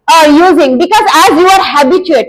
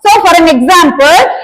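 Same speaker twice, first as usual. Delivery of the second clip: ordinary talk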